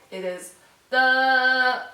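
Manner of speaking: a long, level, slow syllable held flat; first tone demo. Shown as a demonstration. Teacher showing Chinese students the flat tone. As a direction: hold the syllable "It is the" on one flat pitch, drawn out. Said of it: "It's the first tone".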